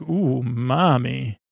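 slow, drawn-out mock "Ooh, mommy"; a hint of hammed-up delight; cheesy catchphrase. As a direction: stretch it out like a corny sidekick's punchline. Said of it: "like, a punchline like".

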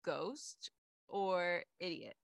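usual speaking voice

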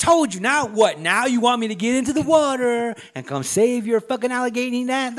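hammed-up yell at someone in the water; mock yelling. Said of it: "I'd just be yelling from the".

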